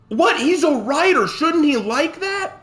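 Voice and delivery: high-pitched mock-shocked questions; hammed-up incredulity; mock disbelief. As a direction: act scandalized, voice climbing high. Mock shock at Tolkien's opinion.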